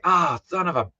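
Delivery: high, mock-annoyed fake curse; mock frustration. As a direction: groan it like a cartoon bummed kid. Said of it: "I was like".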